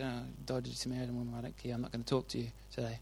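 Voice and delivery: fast, soft, offhand dismissal; dismissive brush-off. Pretend. Jesus imagined snubbing the Samaritan woman, quick soft dismissal. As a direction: wave her away under your breath. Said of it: "if he had just said".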